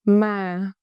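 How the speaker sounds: "Ma" drawn out on a gentle fall. Shown as a demonstration. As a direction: glide down through "Ma". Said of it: "Pitch go down".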